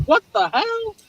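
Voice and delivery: high-pitched disbelief at the price; shocked outrage. Retold or imitated. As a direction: squeal it out, outraged at the bill. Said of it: "I'm like".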